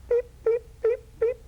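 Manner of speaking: high, clipped electronic beeps; tiny high beeps from a TV screen; imitated beeping. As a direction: chirp it like a warning light. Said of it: "beep, like that".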